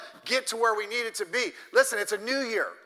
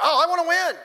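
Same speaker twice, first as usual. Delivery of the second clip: higher, louder eager whine; mock eager whine; 7 semitones higher, 7 dB louder than usual. Pastor mocks lazy athletes, higher and louder. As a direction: ham up the athlete who just wants to win. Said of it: "they're gonna".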